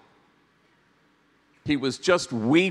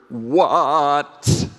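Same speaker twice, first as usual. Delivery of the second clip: louder "What?" at normal pitch, leaning in; pleading teen "What?"; 5 dB louder than usual. Retold or imitated. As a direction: press "What?" toward your mom, less sharp than a shout. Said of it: "He demurs a little, and then he leans into her".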